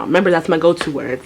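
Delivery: ordinary talk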